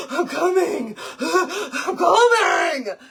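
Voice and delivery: breathless, strained and gasping; out of breath. Retold or imitated. Worn-out mom answering her son from the water. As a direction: push the words out between gasps. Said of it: "I'm like".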